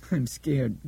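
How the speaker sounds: put-on thin, wavery child voice. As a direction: let the child's voice waver thinly. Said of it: "in a thin, wavery voice".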